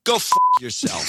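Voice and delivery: snappy comeback with a laugh. Pretend. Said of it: "This time".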